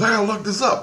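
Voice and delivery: high-pitched, urgent retold exclamation; imitated freak-out. Man rushing to look up the account, mimicked freak-out. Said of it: "He freaked out".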